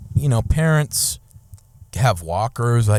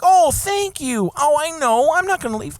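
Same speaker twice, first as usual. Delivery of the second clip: much higher sugary politeness, slowed at first; mock polite reply; 9 semitones higher than usual. Speaker retells her own sugary reply to the neighbor, pitched high. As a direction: play the overly polite driver high and sweet.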